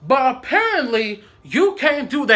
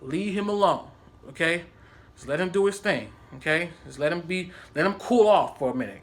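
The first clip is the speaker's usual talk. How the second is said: lower, hushed coaxing; calming; 6 semitones lower, 4 dB quieter than usual. Retold or imitated. Asking Melina to let coworker cool off, calming. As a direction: gently urge her to back off.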